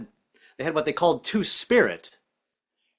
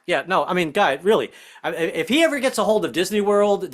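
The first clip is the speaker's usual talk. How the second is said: ordinary talk, nothing marked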